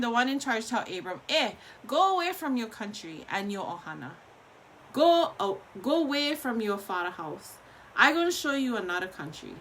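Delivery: ordinary talk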